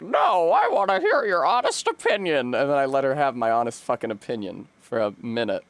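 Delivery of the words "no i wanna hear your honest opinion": put-on higher insistent old lady; old woman at the clinic asking insistently. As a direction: press for an answer as the old lady. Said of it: "she was like".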